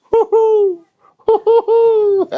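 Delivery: squealing woo-hoo way up high; delighted falsetto woo-hoo cheer; high falsetto whoops. Real, not acted.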